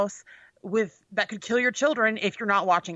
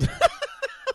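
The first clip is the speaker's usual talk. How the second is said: high airy laugh. Real, not acted. Laughing at the headline.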